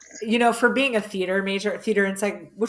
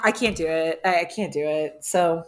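ordinary delivery

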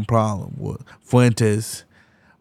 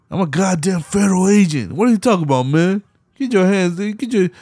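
higher, hammed-up Roman outburst; imitating an agitated Roman, higher; mock Roman; 7 semitones higher than usual. Retelling the movie to a friend, a hammed-up higher agitated Roman.

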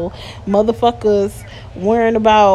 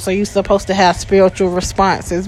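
ordinary talk

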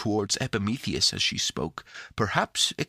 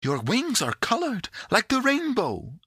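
high-pitched, delighted wonder; exclaiming; 13 semitones higher than usual. A narrator's reading. Pandora admires the creature's wings, exclaiming. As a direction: marvel aloud, voice pitched right up. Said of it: "exclaimed Pandora".

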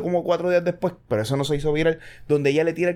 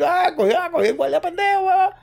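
high mocking jeer, far above the usual voice; gloating taunt; 13 semitones higher than usual. The driver who escaped mocks the dumb officer, jeering high. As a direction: crow it like you just fooled a cop.

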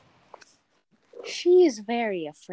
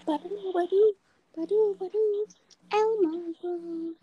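high squeaky puppet voice; sing-song like a kids' puppet; Elmo; 10 semitones higher than usual. Pretend. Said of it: "I'm turning myself into Elmo".

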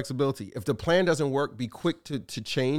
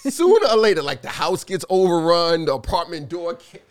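loud, higher, hammed-up horror-movie telling; dramatic build; 4 semitones higher, 8 dB louder than usual. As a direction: play up the doom like a trailer. Acting out zombies overrunning house.

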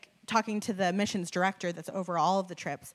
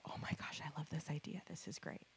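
hushed, whispered excitement; very soft, breath-only glee; delighted whisper; 5 semitones lower, 17 dB quieter than usual. Retold or imitated. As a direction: whisper it like a secret thrill. Retold delighted reply to God.

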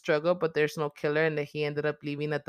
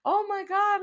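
much higher, slow and stunned; shocked disbelief; slower than usual. Retold or imitated. Reliving her reaction to the stabbing, shocked disbelief. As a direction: gasp it out in disbelief. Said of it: "I was like".

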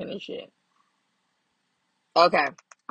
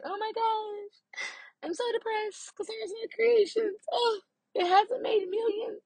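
mock crying; 14 semitones higher than usual. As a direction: whine it like a hammed-up breakdown. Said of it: "I was going in on myself".

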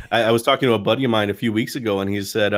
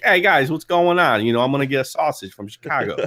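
jokey, exaggerated Chicago accent, pitched up; big Chicago-accent greeting, stretched out and higher; dramatic Chicago accent; 5 semitones higher than usual. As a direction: ham up a Chicago super fan accent. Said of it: "a little bit more, you know, dramatic".

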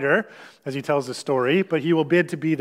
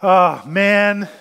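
louder, exasperated sigh of words; 5 dB louder than usual. Acted. Jesus answers the man in the crowd, exasperated. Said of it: "It's a little exasperated".

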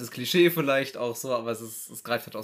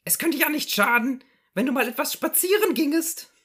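higher, pestering housewife voice; nagging wife; 14 semitones higher, 7 dB louder than usual. Acted. Wife nudging her resting husband, nagging. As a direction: fuss over him like a nagging wife. S0 plays wife in Loriot sketch.

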